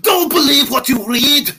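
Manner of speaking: loud, high mock-Germanic cry; accented outburst. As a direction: cry out a warning in a hammed-up accent. Kozlov's acted angry retort.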